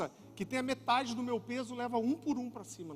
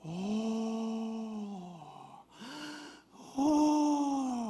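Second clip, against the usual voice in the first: imitated baby's awe. Father imitating his little son seeing the TV characters, mock awe. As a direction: coo in wide-eyed wonder, holding the note. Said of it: "começou a fazer assim".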